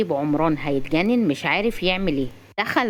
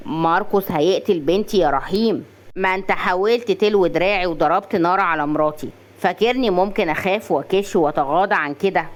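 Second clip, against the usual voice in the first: usual speaking voice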